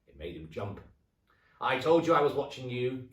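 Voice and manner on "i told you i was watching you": loud, high gloating hag; cackling old woman, louder. Acted. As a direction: crow over him like a witch. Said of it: "cackled Missus Twit".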